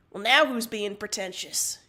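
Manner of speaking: loud snide comeback; mocking counter; snide retort. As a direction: fire back with a sneer. Retort in argument.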